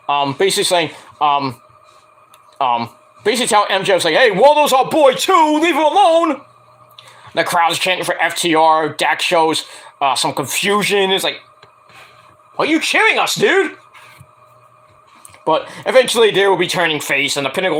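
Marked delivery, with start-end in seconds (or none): 4.1-6.4 high, raised imitation of an upset wrestler; mock protest. Recapper voicing upset tag team to MJF, high mock protest. 12.6-13.8 high-pitched puzzled imitation; mock confusion. Recapper voicing confused wrestler to cheering crowd, high mock bafflement.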